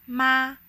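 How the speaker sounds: "ma" held high and flat; first tone. Shown as a demonstration. Teacher demonstrating the first intonation, "ma" high and flat. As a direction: keep "ma" on one high, level pitch. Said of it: "a very high and flat pronunciation".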